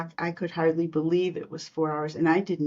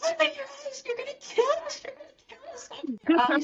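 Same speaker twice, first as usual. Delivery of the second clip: imitating panicked friend; faster than usual. Friend in the car begs the driver, much higher panicked imitation. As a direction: act out the terrified passenger begging. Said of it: "Sandy is screaming".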